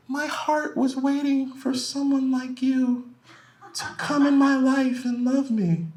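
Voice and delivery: put-on high, slow, sweet girlfriend voice; dreamy, slow, high girlfriend impression. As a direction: coo it like a smitten girlfriend. Girlfriend's tender answer.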